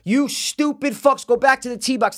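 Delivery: very high re-enacted orders; exasperated yelling. Golfer ordering the older men back, exasperated. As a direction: order them back, pitch way up.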